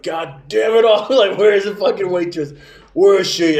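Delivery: mock angry shout. Grandpa curses about a missing waitress at the table, mock angry shout. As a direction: bellow it like a furious old man, imitated. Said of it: "so clearly it's out loud".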